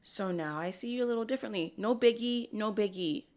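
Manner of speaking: ordinary talk, nothing marked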